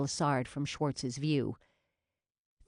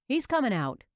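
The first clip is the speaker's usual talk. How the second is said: urgent; 10 semitones higher, 5 dB louder than usual. A narrator's reading. Officer calling out, tense standoff.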